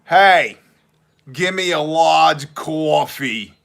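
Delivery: loud, slow New York order at the counter; a brash Brooklyn guy ordering coffee; a Brooklyn guy calling out his coffee order, New York accent. Retold or imitated. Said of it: "the guy behind me goes".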